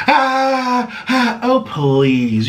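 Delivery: high, hammed-up whimpering moans; mock overacting moan. Mocking James overacting at a TikTok, high hammed-up whimper. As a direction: ham up a fake pained protest. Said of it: "And he's like".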